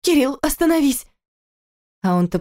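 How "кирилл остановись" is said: high panicked plea. A narrator's reading. Wife in pain begs husband to stop, panicked. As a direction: cry out for him to stop. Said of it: "донеслось паническое".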